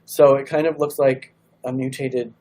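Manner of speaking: ordinary talk, nothing marked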